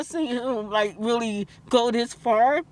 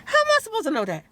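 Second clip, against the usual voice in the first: indignant rant; 9 semitones higher than usual. Real, not acted. Angry patient venting about the dentist, high indignant outburst. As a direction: fire it back, fully exasperated.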